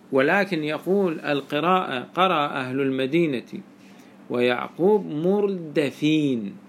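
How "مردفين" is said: مردفين said murdafin, with an 'a' after the d; fatha on the dal. Shown as a demonstration. Teacher showing the Medina reading, مردفين with fatha on dal. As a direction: say مردفين slowly as mur-da-fin, open vowel on the dal. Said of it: "بفتح الدال".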